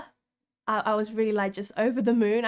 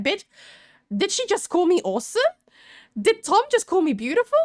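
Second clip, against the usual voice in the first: high, louder incredulous questions; giddy disbelief; 8 semitones higher, 4 dB louder than usual. Retold or imitated. Fan to her friend after the stars leave, giddy disbelief. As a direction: re-enact stunned disbelief, pitched right up. Retelling stunned reaction to compliments.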